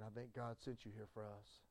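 Tony's plea recalled quietly in a daydream; soft remembered voice. Retold or imitated. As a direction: let it float back like a memory. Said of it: "Tony Davis saying".

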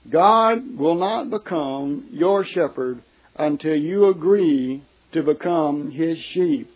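usual speaking voice

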